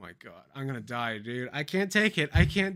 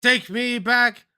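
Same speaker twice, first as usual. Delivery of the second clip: mock pleading; 3 semitones higher, 4 dB louder, slower than usual. Melodramatic plea to old self.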